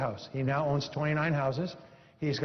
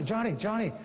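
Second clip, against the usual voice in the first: put-on higher, astonished call to the boy; calling out amazed; 7 semitones higher than usual. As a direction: shout his name in disbelief. Parents calling into the garage.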